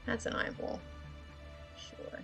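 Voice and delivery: normal talking voice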